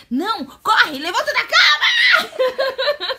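loud laughing panic shout. Real, not acted. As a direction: yell her out of bed. Panicked yelling at daughter.

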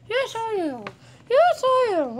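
high-pitched playful shout; playful excited. Real, not acted. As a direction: rile up the dog. Playing with dog.

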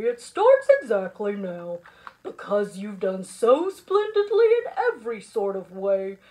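low, loud ceremonial announcement; booming, pompous speech; proclaiming principal. Acted. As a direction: declare it grandly to the school. Principal makes a grand proclamation.

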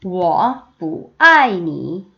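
very slow, each syllable drawn out; slow choral reading; slow read-along. Shown as a demonstration. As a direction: read slowly with the class. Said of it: "같이 읽어요".

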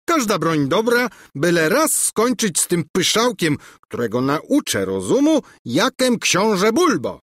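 high, loud swagger; puffing himself up; boasting prince. A narrator's reading. Prince boasts about duel. (przechwalający się książę)

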